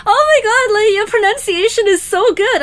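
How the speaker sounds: very high, loud squealed imitation; mock gushing. Classmates hearing a foreigner's English word, gushing squeal. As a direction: gush in a put-on squeal of amazement. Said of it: "everybody burst out laughing".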